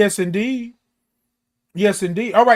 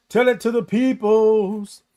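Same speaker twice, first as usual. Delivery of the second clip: preacher call-back; 3 semitones higher, slower than usual. Acted.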